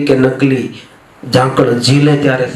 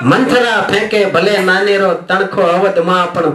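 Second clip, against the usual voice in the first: measured, rhythmic verse delivery; slow, stately recital with a slight hold on key words; poem recital; 4 semitones higher than usual. Acted. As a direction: recite it like a poet to a live crowd. Poet reciting his verses on stage.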